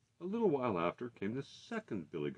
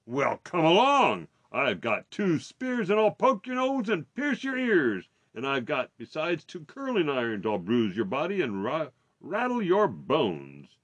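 defiant big goat; 6 semitones higher, 6 dB louder than usual. A narrator's reading. Big goat daring the troll to fight, defiant bellow.

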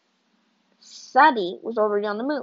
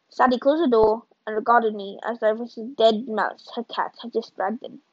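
ordinary delivery, nothing marked

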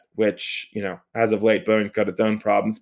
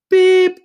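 high, loud held beep; imitated censor beep; 9 dB louder than usual. Storyteller censoring the angry driver's insult, loud mock beep. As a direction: cover the swear with a shrill beep.

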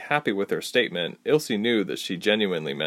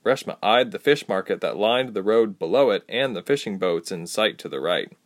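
plain reading voice

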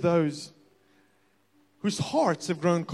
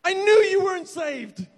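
high, slow, snooty judgment; mock self-righteous; 10 semitones higher, 5 dB louder than usual. Preacher mocks his own judging thought, high and slow. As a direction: look down your nose at them.

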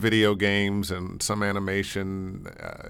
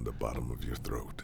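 low voice; 11 semitones lower, 12 dB quieter than usual. Shown as a demonstration. Describing low morning voice.

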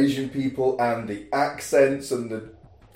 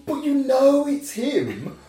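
incredulous; 9 semitones higher, 6 dB louder than usual. Retold or imitated. Watching Tammy, protesting at the screen in high disbelief. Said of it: "and I'm like".

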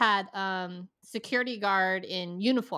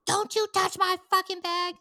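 high, sharp furious warning; snapping customer; 10 semitones higher, 3 dB louder than usual. Retold or imitated. Customer yelling at clerk not to touch his bag, high and angry. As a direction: bark it to keep her hands off. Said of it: "he's like".

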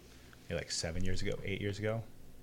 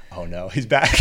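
laughing mock dread; 8 dB louder, slower than usual. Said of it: "he's like".

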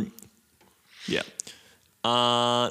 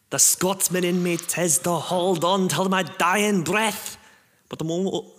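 bad Irish accent; 7 semitones higher, 4 dB louder than usual. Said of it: "me bad Irish accent".

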